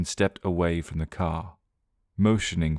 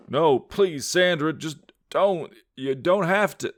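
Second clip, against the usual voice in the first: voice breaking shout; 11 semitones higher, slower than usual. Acted. Johnny chasing his sister in the fog, shouting desperately. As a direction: shout after her, voice cracking. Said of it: "his voice breaking as he shouted".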